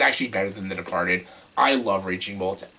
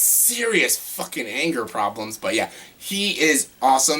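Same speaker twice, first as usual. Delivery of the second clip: ordinary delivery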